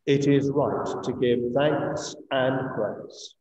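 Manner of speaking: ordinary talk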